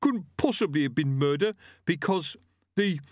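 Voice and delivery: high-pitched, put-on aggrieved voice; protesting butcher. Executed killer starting his defence, aggrieved and faltering. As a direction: argue high, then stall. Gripper's held voice.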